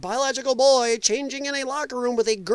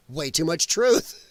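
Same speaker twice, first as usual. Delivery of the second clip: ordinary talk, nothing marked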